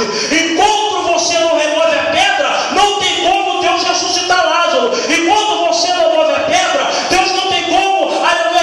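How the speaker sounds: fiery preacher pushing the church to act; full-force preaching. Acted. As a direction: hammer it home to the congregation.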